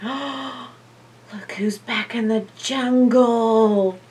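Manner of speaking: slow breathy whisper; hushed slow whisper; excited whisper. Acted. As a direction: share the secret with the kids. Excited secret aside to kids.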